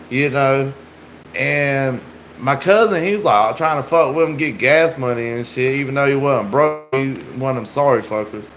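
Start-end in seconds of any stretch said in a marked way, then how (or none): none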